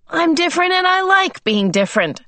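loud, high and insistent; unnaturally loud. A narrator's reading. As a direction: say it far too loudly, pitched high. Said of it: "Calvin's voice was unnaturally loud".

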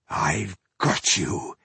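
slow, low gruff growl; dwarf growl. Acted. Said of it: "he growled".

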